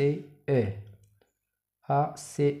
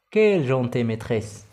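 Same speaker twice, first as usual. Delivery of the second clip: "Quelle" punched, louder, like an exclamation; exclamative reading; 5 dB louder than usual. Shown as a demonstration. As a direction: read it as an exclamation, stressing "Quelle". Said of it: "إذن نحولوها نقولو مثلًا". (قراءة تعجبية مع نبر على "Quelle")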